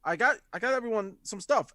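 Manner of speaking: high, bright-eyed proud call; eager announcement. Acted. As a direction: show off the haul to the party. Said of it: "Jalden comes back and says".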